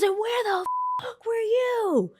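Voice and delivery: very high, slow, outraged shriek; mock furious mom. Mom demanding where the worker was, high slow shriek. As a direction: stretch it out in a high outraged screech. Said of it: "She goes".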